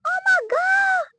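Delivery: high, drawn-out exclamation; amazed high-pitched shout; excited outcry. Real, not acted. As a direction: cry it out loud, stretched long. Startled reaction during gameplay.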